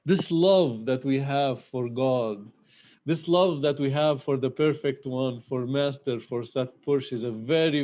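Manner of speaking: normal talking voice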